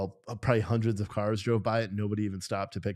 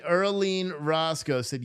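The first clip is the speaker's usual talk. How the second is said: ordinary delivery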